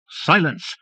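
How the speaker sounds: high snarled command. A narrator's reading. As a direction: snap it at him. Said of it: "the woman snarled".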